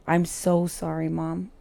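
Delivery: hushed, understated retelling of a sobbing apology; tearful apology. Retold or imitated. Crying son apologizes to his mom, retold softly and mournfully. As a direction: softly imitate a distraught, sorry son. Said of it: "he is bawling like crazy".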